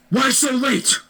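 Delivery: high mock-stern teacher demanding an answer; scary teacher. Teacher scolds late Wyatt, mock stern and high. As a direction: ask it like an irritated teacher.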